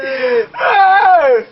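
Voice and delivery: high shocked cry of hey; a startled high hey; shocked shout. Acted. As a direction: yelp it out in disbelief. Shock at hundred thousand naira.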